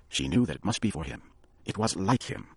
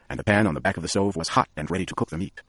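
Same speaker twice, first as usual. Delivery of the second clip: plain reading voice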